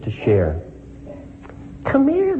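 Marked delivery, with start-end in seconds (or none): 1.8-2.4 put-on much higher, easygoing old man's call; friendly old man beckoning. A narrator's reading. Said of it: "in the easy vernacular".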